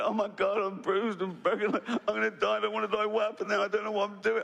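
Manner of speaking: high, frantic babbling; mock panic. Crash survivor bobbing in the Atlantic, panicking.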